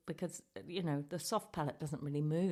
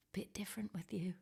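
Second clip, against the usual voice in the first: whisper; 3 semitones higher, 6 dB quieter than usual. Acted. Puppet whispers into the mic.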